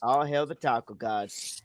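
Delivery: low, soft repeat of the chant; hushed mock homage; subdued echo. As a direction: say it back quietly and low. Same words repeated quietly after shout.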